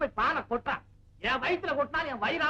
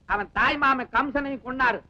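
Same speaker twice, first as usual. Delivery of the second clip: ordinary talk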